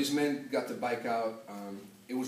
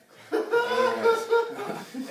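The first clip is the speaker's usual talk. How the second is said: big, high burst of laughter; loud laugh; 13 dB louder than usual. Real, not acted. Bursts out laughing at own story.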